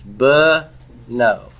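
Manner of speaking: beno slowly as "buh ... no"; broken into syllables. Shown as a demonstration. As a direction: draw out beno, short-e first syllable "buh", then "no". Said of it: "notice how it's spelled".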